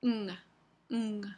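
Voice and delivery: ng hummed at the back of the mouth; a held nasal ng; velar ng sound. Shown as a demonstration. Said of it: "Este símbolo se pronuncia".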